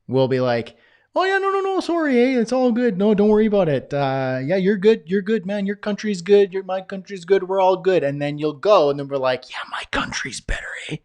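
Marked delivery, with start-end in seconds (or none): 1.1-8.0 much higher, louder, gushing Canadian niceness; high, bright mock-Canadian apology; overly polite Canadian. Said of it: "We don't always talk like that". 9.5-11.0 whispered sly Canadian aside; smug whisper. Retold or imitated. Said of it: "We don't always talk like that".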